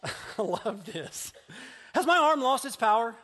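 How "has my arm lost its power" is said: higher and louder, a mock-offended God; God indignant. God answering doubting Moses, mock offended. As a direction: boom it like a proud, insulted God. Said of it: "Then the Lord said to Moses".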